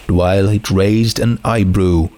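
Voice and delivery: ordinary delivery, nothing marked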